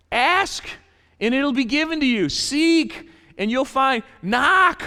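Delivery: high, loud proclamation; preaching at full force. Acted. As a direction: call it out like a promise. Preaching Jesus' words.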